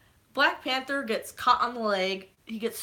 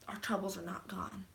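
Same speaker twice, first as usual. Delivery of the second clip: ominous whisper; 3 semitones lower, 10 dB quieter than usual. Shown as a demonstration.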